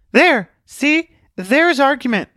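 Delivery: high, loud, bursting exclamations; eager child spotting the trick; excited little girl. Acted. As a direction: point it out jumping with excitement. Truth jumps and points.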